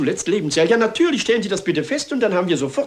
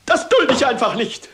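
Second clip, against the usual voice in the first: higher, raised indignant protest; indignant outburst; 7 semitones higher than usual. Acted. Captain fuming at the inspector over all the questions, indignant. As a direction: bluster at the inspector.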